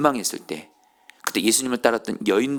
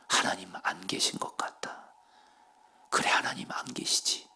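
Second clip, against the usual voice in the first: despairing whisper; 3 semitones lower, 8 dB quieter than usual. Acted. To himself in the cell, a hushed despairing whisper. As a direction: breathe the doubt out, barely voiced.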